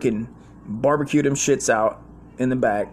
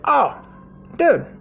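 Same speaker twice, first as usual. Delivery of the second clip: much higher, loud put-on boss voice; old boss voice; 9 semitones higher, 3 dB louder than usual. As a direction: play the crusty vet handing over pills. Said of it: "He's like".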